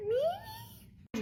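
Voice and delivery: tiny, very high squeal; sky-high little squeak; squeaky disbelief. Acted. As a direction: squeak it out in a very high mock-coy voice. Mimes reacting to being eyed.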